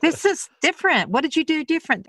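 high, wary retold quote; suspicious questioning. Daughter asking mother about altered chicken, suspicious.

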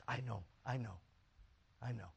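very soft, low reassurance; hushed, low and soothing; gentle consoling. Retold or imitated. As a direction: murmur comfort to someone in tears. Pastor consoling crying wife.

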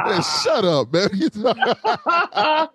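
laughing through a mock shut up; laughing son impression. Dad imitates his son laughing at the teasing, laughing. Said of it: "he's just like".

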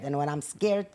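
normal talking voice

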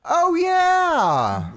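high, loud, drawn-out cheer; hyped cheer. Pretend. Co-host hypes the club shout-out, drawn-out cheer. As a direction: stretch it high, then let it slide down.

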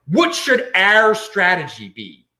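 our leaned on, slow; slow, deliberate stress on "our". Shown as a demonstration. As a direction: press the word "our" in "What should our strategy be?". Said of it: "I'm stressing the word "our".

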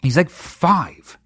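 ordinary talk, nothing marked

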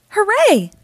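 high, loud joyful hooray; excited cheer. Acted. Kids finding presents under pillows, excited cheer. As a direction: cheer like it's Christmas morning. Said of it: "cry the kids".

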